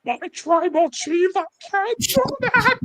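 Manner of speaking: hammed-up pleading on the edge of tears; Paul Heyman. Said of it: "Paul Heyman with tears in his eyes goes".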